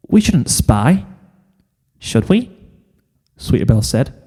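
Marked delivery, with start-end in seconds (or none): 0.1-2.5 hesitant filly. Acted. Sweetie Belle hesitant.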